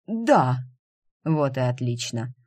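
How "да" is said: резко, выше и громче, с усилием; испуганный отец выдавливает согласие; выдавил. A narrator's reading. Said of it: "а потом выдавил". (выдавил через силу)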